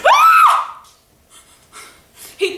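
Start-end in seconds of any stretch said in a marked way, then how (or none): 0.1-0.8 piercing high scream of terror. Retold or imitated. Fry cook screaming at the robber, piercing high shriek. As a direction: shriek like a gun just appeared. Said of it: "the girl on the fry station screamed".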